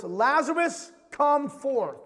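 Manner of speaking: loud commanding cry. Acted. Jesus calling dead Lazarus out of the tomb, loud cry. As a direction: summon him with a loud, ringing command. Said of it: "cried with a loud voice".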